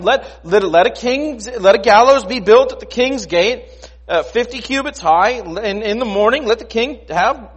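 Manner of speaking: high, loud, insistent egging on; loud, high-pitched scheming advice; urging friends. Acted. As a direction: push him to act as if it were obvious. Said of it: "his wife Zeresh and all his friends said".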